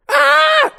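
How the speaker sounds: piercing high, loud scream. Acted. Barry and cricket see each other and scream. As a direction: shriek as if hanging on for dear life. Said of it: "scream in unison".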